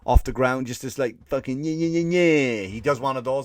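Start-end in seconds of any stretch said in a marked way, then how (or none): none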